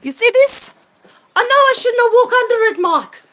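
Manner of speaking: excited near-yell; agitated shout. Real, not acted. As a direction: blurt it out, wound up. Agitated showing damage.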